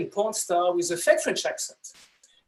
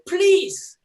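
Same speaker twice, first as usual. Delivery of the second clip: high, loud acted plea; pleading reply; 12 semitones higher, 8 dB louder than usual. Husband always answers his wife, high mock plea. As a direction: burst out with one high word. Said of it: "I always answer the same way".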